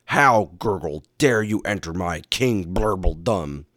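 loud, indignant underwater villain; crying out as villain. A narrator's reading. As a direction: challenge the intruder in a gurgling villain voice. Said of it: "he cried".

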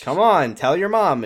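retold coaxing. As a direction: coax playfully against a refusal. Retold man coaxing after a refusal.

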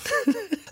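high laugh. Real, not acted. Laughs at detox joke.

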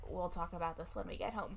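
low, soft, dismissive dad; a muted imitation of a cold father. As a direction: shut the conversation down coldly. Dad ignores mom.

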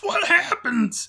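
very high, drawn-out squeal; excited anticipation. Real, not acted. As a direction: squeal it slowly, dying to know. Fan impatient to know the sequel.

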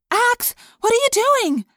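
high, loud, slow alarmed demand. A narrator's reading. Cassie confronting Ax at Jake's throat, loud demand. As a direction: stop him right now. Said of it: "Cassie demanded".

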